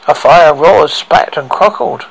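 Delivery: ordinary delivery, nothing marked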